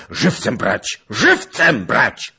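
loud, high command, building on the repeat; commanding battlefield shout; shouted order. A narrator's reading. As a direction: yell an urgent order over battle. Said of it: "krzyknął".

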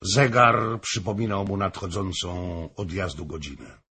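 ordinary delivery

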